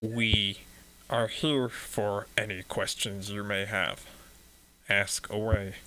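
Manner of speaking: lightly voiced monotone priest. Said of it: "He speaks in this monotone voice".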